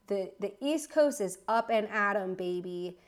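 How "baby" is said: ordinary talk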